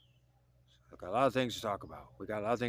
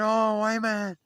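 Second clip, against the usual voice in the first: higher and much louder, a mock groan of disbelief; exasperated elder; 12 semitones higher, 12 dB louder, much slower than usual. The elder, pipe gone out again, groaning at the guest in disbelief. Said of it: "He said".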